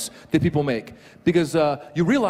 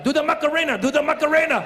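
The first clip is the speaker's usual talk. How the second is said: high, mock Mexican-accent urging; Mexican referee; 9 semitones higher than usual. Referee urging the injured player to dance, high Mexican accent. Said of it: "he could have been like".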